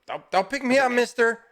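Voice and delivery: put-on high, pleading little boy voice; a frightened child begging not to be lifted; scared little kid.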